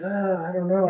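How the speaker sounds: a hint of put-on whiny hesitation; hesitant older person. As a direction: imitate a reluctant fifty-year-old dragging it out. Imitates hesitant fifty-year-olds.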